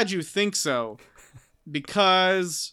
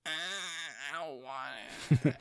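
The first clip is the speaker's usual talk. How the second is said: much softer, very slow, reluctant mumble; a timid, hesitant no; mock meek refusal; 9 dB quieter, much slower than usual. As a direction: drag it out like you'd rather not.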